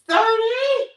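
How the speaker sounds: loud, high final count; shouted count. Real, not acted. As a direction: belt out the last number in triumph.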